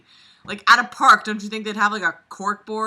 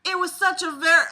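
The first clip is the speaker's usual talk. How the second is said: high, loud Southern Belle; 9 semitones higher, 8 dB louder than usual. Acted. Reader starting the next line, high Southern Belle accent. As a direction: break off a Southern Belle reading. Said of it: "do the Southern Belle voice".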